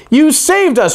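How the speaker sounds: high, bright grateful shout; the frogs thanking the toad. A narrator's reading. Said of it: "shouted the frogs".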